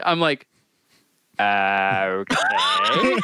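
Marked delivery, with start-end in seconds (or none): 1.4-3.2 mock-bewildered, drawn-out reluctant agreement; dumbfounded acceptance. Young mower told he won't get paid, dumbfounded acceptance. Dumbfounded at Tom's no-pay threat.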